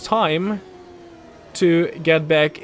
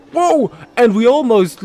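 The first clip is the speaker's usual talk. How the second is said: high, loud whoa; sudden real alarm; startled shout; 7 semitones higher, 8 dB louder than usual. Real, not acted. Car nearly spins.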